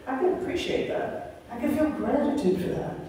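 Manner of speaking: soft, hushed reflective voice; softly guiding the listeners into gratitude. Shown as a demonstration. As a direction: speak it like a quiet thank you. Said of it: "close your eyes".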